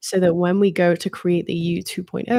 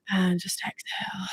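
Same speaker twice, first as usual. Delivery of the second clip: right in your ear; 8 dB quieter than usual. Acted. Breathing cue in meditation.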